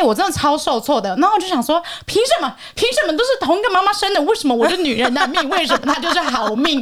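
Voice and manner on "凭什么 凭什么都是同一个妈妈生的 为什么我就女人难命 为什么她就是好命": higher, fired-up why me complaint; mock indignant protest. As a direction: play your own outrage with a laugh. Indignant inner protest about fate.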